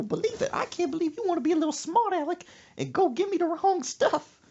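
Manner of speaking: high-pitched screechy rant; mock scolding mama. Mother berating smart-aleck son, high mock scolding. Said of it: "She said".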